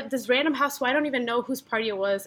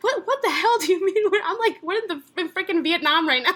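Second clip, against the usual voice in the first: exasperated; 6 semitones higher than usual. Retold or imitated. Outraged reply to calm friend.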